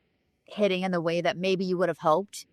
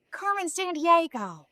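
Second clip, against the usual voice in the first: slow, higher, dreamy name drop; a touch of the Coolidge voice; playful diva voice; 9 semitones higher, slower than usual. Pretend. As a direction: drawl the name like a glamorous star. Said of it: "can I just be her?".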